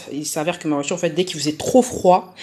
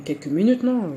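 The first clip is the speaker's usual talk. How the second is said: ordinary talk, nothing marked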